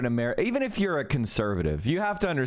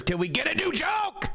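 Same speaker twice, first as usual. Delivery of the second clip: much higher, shouted plea; exasperated shout; 13 semitones higher than usual. Real, not acted. Fed-up commentator demands new jokes, shouting high. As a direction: yell it in real frustration.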